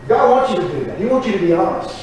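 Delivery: ordinary delivery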